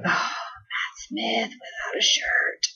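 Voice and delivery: soft, slow, awed whisper; mesmerized whisper. Retold or imitated. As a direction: breathe it out, starstruck. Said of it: "I was just mesmerized".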